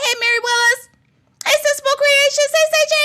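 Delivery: high, loud, quick hellos; eager high call-outs; excited greeting. Real, not acted. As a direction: shout out names excitedly.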